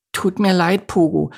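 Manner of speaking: high, timid character voice. A narrator's reading. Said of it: "sagte Miri zaghaft".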